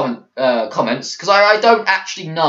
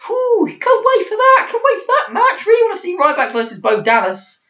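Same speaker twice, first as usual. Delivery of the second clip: very high, squealing sarcastic hype; mock excitement. Sarcastic hype before saying whatever.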